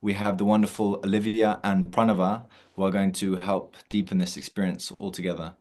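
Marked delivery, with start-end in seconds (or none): none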